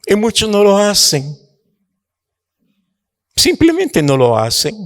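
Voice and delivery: high, driving rebuke from the pulpit; preaching at full force. Acted. Preacher scolding the church for not giving, in a high fervent preach. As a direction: hammer home the people's disobedience.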